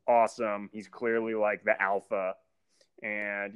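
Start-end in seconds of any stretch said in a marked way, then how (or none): none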